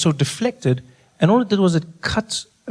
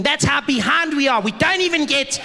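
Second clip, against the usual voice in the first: high, whiny put-on idiot voice; complaining idiot; 9 semitones higher than usual. Some idiot griping about the country, mock complaining. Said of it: "there's still some idiot out there going".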